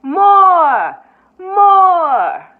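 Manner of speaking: loud eager shouts; shouting crowd. A narrator's reading. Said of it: "a chorus of others shouting".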